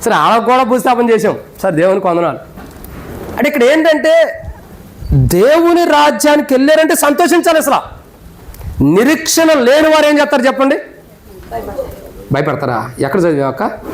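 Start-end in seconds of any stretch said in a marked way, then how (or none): 3.4-10.8 raised, impassioned pulpit voice, driving each question home; preaching at full force. Acted. Pastor to funeral congregation, preaching at full force.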